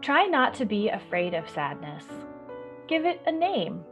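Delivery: plain reading voice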